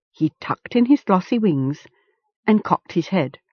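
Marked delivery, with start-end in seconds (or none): none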